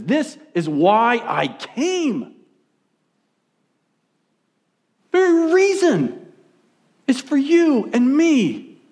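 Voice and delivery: slow, high-pitched proclamation; preacher voicing Jesus, slow and emphatic; impassioned declaration. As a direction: stretch each phrase out with conviction. Jesus declaring his purpose, acted.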